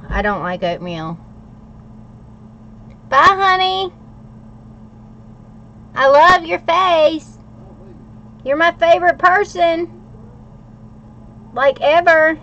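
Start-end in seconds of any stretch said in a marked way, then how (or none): 3.1-12.4 high, loud, doting farewell to her husband; sweet goodbye. Real, not acted. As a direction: gush at him as he walks out the door.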